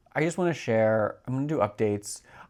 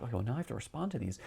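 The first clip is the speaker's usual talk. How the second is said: quick aside to yourself; 7 dB quieter, much faster than usual. Retold or imitated. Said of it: "You're like".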